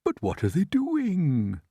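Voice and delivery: high, slow, voiced stage whisper; puzzled old creature; old grasshopper's whisper. A narrator's reading. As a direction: wonder aloud, slowly and high. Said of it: "the old green grasshopper whispered".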